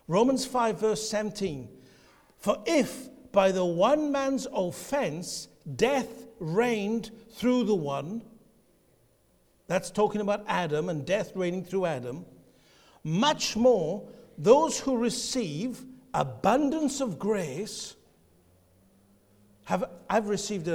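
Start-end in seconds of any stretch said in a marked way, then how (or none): 2.4-8.2 slow scripture reading. Shown as a demonstration. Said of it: "listen to each word". 13.0-17.9 slow scripture reading. Shown as a demonstration. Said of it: "listen to each word".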